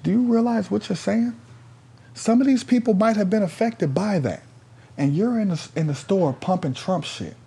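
very high, incredulous scolding; retold indignant reproach. Storyteller scolding the man pumping Trump in store, indignant. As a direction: reproach him in a high, insistent pitch.

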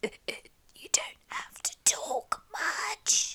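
whispery croaky sick voice; Daniel impression. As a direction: imitate a hoarse husband with a cold. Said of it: "That's my impression of Daniel right now".

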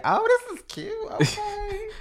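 high, far put-on posh lady voice; high mock-bougie squeal. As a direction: chirp it up like a fancy shopper. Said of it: "I will go in places like".

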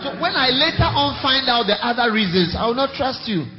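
loud, high preaching burst; emphatic warning. Real, not acted. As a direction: declare distrust forcefully to the congregation. Warning the congregation of lost trust.

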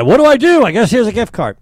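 imitating dad, loud and high. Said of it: "That's my dad".

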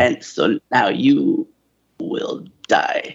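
unvoiced menacing villain whisper; Emperor whisper. Emperor impression in retelling.

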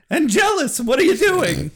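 real laughing shout at the TV; laughing call-out. Real, not acted. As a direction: call out to the screen, cracking up. Laughing call to a character on screen.

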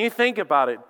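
ordinary talk, nothing marked

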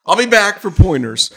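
loud, laughing through the line; chuckling out the punchline. Real, not acted. Laughing at his own joke.

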